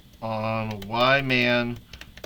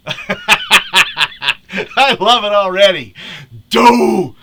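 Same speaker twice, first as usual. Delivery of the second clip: loud, high laughing Homer voice; Homer Simpson; 7 semitones higher, 11 dB louder, much slower than usual. Acted. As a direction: ham it up as a cartoon dad. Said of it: "In the voice of Homer Simpson".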